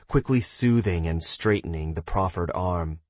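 ordinary delivery

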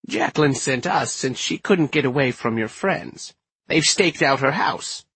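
put-on high-pitched feisty old lady character; feisty old woman. Inez explains why they came, feisty old woman's voice.

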